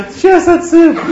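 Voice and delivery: high, loud, laughing imitation of a boy; baffled yell. Confused boy answering his doctors, comic baffled yell. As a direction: cry it out in comic bewilderment.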